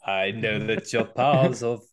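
lightly voiced Scar, sung-spoken; menacing lion half-sung; Jeremy Irons voice. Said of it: "Jeremy Irons as, uh, Scar".

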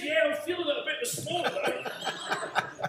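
high, loud quip through a laugh; laughing old man. Retold or imitated. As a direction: chuckle it out as the old bloke. 103-year-old jokes about his age.

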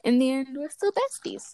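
ordinary talk

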